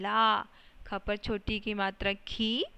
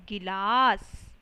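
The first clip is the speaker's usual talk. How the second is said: slow blended word; 7 dB louder, much slower than usual. Shown as a demonstration. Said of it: "ग पर छोटी की मात्रा गी".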